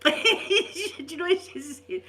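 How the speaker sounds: slow and high, laughing through it. Real, not acted. Gran giggles, about to share the birth story. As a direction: giggle as you set up the story.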